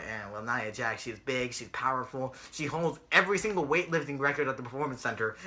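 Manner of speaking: low, soft mock commentary; understated, dismissive announcer voice; Michael the commentator. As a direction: imitate an announcer droning through talking points. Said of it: "and Michael's all like".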